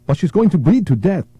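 high, urgent plea; pleading woman. Acted. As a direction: beg to save the girl. Woman pleads for injured girl.

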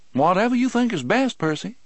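high, lightly voiced calm reply; unbothered, mild tone; mild placid guard voice.

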